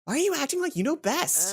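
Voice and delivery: heated scolding. Acted. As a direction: throw it back at her, heated.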